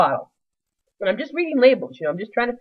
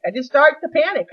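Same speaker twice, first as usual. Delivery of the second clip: rising panic; 6 semitones higher than usual. Shown as a demonstration. Telling the audience about her panic, voice rising. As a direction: let the panic climb on the repeat.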